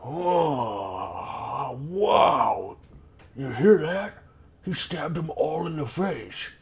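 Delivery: high, drawn-out goblin wonder; awed goblin. Acted. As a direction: marvel to your goblin mate. Goblin tells companion in awe.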